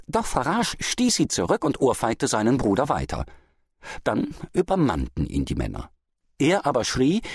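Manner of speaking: usual speaking voice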